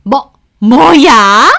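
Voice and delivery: loud, very slow stunned outburst; shocked mother-in-law. Retold or imitated. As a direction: sputter in disbelief. (며느리 말에 기가 막힌 시어머니, 크고 느린 외침)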